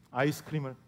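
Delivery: put-on soft repeat of 아이스크림을 to the kids in the car; quiet echo. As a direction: murmur 아이스크림을 again as a soft afterthought. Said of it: "제가 이렇게 말했습니다".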